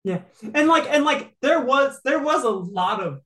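ordinary talk, nothing marked

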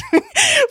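short squeal of laughter; quick giggle; high laugh. Real, not acted. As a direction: burst out laughing. Laughs about stalking videos.

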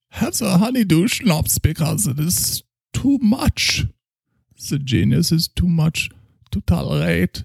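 put-on a drunk German doctor praising his schnapps; heavy German accent, tipsy. Character continues.

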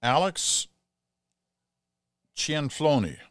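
Italian accent with a rising lilt. Shown as a demonstration. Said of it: "I kind of put an Italian accent into that".

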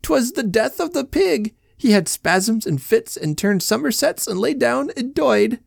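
high, slow tearful telling; mournful lament. Acted. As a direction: mourn the pig, pitched high. Mrs Casey lamenting.

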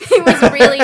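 laughing. Real, not acted. Laughing while telling.

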